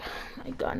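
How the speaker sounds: breathy whispered oh my God; fearing the whole school's judgment, whispering; whispered worry. Retold or imitated. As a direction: whisper it, dreading the gossip. Fearing bully reputation.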